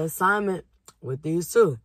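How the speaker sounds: speaking between bites; talking while chewing. Real, not acted. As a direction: mouth partly full of food. Seen 2: Holds nugget, bites it at 1.1, then talks while chewing.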